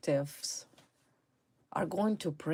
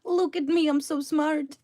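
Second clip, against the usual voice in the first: high, gleeful gloating, mocking the woman; 9 semitones higher, 3 dB louder, slower than usual. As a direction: sneer at her with a much higher, louder mock cheer. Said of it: "She's like".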